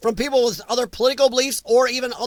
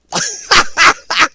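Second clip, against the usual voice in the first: a big real burst of laughter, higher and louder; 8 semitones higher, 8 dB louder than usual. Real, not acted. Laughing out loud at a viewer's comment.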